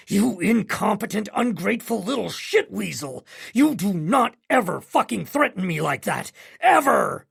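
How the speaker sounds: a father losing control, shouting down the phone; bellowing father. A narrator's reading. Father bellowing at his son over the phone, furious. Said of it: "my father bellows".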